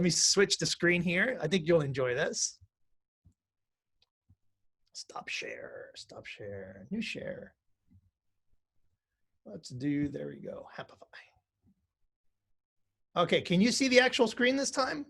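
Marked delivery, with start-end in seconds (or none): 4.9-7.5 whispered aside to self; under breath. Real, not acted. Muttering to self while switching screens. 9.5-11.1 barely audible whisper to self; whispered aside. Real, not acted. Muttered aside while setting up.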